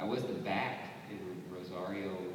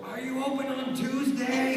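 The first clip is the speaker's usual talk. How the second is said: much higher and louder, slow nonsense question; silly high voice; 9 dB louder, slower than usual. Acted. Actor pops into a shop with a high goofy question. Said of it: "just run into somewhere and go".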